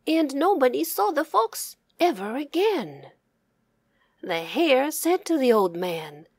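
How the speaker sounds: usual speaking voice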